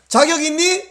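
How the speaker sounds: high-pitched challenge as God; challenging question. Shown as a demonstration. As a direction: call it out to prompt the congregation. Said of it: "제가 자격 있니 그러면".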